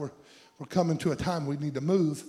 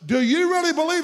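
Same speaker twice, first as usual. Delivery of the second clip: voice of God; 9 semitones higher, 7 dB louder than usual. Retold or imitated. Preacher voicing God questioning Ezekiel.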